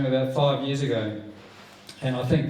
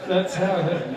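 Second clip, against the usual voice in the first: normal talking voice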